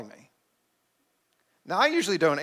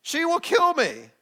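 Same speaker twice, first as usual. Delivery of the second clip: much higher, slow hammed-up dread; mock panic; 12 semitones higher, slower than usual. Repeating to Chuck that his wife will kill him, mock panic. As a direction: wail it like a doomed man.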